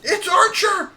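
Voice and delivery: imitating wife's high-pitched, thrilled scream; mock squeal at full volume; excited scream. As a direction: shriek it like an overjoyed dog fan. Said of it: "and she screams".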